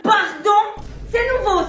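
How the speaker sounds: outraged pardon. Acted. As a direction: snap back in disbelief. Mother outraged at refusal. (Pardon? indigné, crié plus haut)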